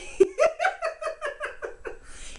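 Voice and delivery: high-pitched giggling laugh; real laughter at his own joke. Real, not acted.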